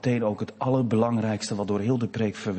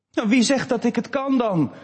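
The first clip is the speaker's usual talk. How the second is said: put-on high, louder childish challenge; boy calling down; 13 semitones higher, 6 dB louder than usual. Boy on the slide calling down to his sister, high and doubtful. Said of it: "riep hij naar beneden".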